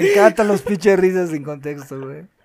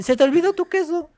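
high teasing quote; sing-song mock of the clerk; high-pitched teasing tone; 11 semitones higher than usual. Mocking quote from store clerk.

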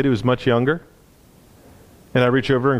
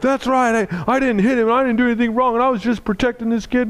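put-on high, self-righteous kid protest; indignant teen; 12 semitones higher than usual. Young [name] defending himself to both dads, high indignant protest. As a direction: insist you did nothing wrong. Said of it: "I'm like".

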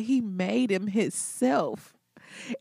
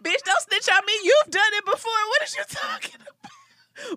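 laughing protest; 9 semitones higher, 4 dB louder than usual. Real, not acted. Caught out, protesting through laughter.

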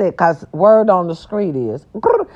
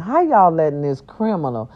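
usual speaking voice

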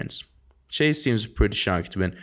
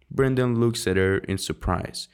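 ordinary delivery

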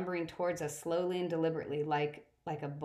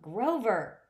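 calling out; 4 semitones higher, 5 dB louder than usual. A narrator's reading. Calling out to unconscious friend.

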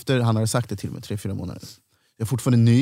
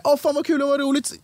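much higher, overly bubbly; put-on enthusiasm; 13 semitones higher than usual. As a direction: fake the thrill of a happy host. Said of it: "vara den här". (spelad entusiasm, mycket högre röst)

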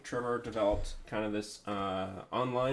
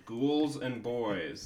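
slow; much slower than usual. Acted. Slow drawn-out pun.

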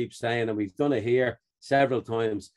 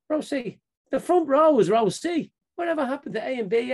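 surprised; 11 semitones higher than usual. Retold or imitated. Surprised at front-row seats.

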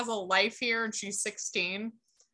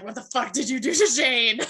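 imitating angry Dawn; 5 dB louder than usual. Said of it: "Dawn is like".

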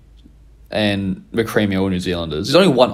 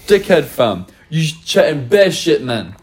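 drawn-out, hammed-up British slang; mock British accent; 4 semitones higher, slower than usual. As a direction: trade insults in a put-on British accent. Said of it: "doing a British accent".

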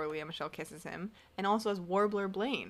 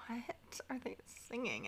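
unvoiced, slow whisper; 3 semitones higher, 11 dB quieter, slower than usual. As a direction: whisper it confused to a neighbor. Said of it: "[name] being like".

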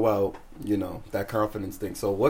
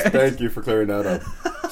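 slow, laughing repeat of the thanks; 2 semitones lower than usual. Real, not acted. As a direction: let the laugh run through a slow thank-you.

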